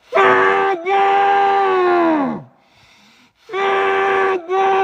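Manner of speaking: desperate shouted Chega into a cloth; screaming. Shown as a demonstration. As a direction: scream Chega until the voice cracks. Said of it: "ó, grita".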